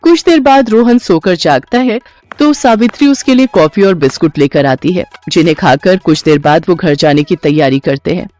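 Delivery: usual speaking voice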